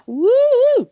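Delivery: high, loud whoop; bright celebratory "woo"; excited woo. Real, not acted. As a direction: let out a rising, drawn-out cheer. Cheering after praising the comic.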